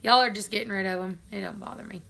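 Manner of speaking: normal talking voice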